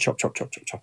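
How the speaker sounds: quick, whispered knife chops; hushed chopping imitation. Retelling a film's vegetable-chopping scene, hushed imitation. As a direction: imitate repeated chopping under the breath.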